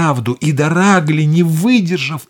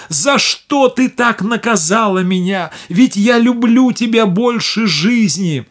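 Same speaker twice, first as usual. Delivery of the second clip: high, loud tearful pleading king; tearful plea; 7 semitones higher, 5 dB louder than usual. Acted. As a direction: plead through tears. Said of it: "заливаясь слезами".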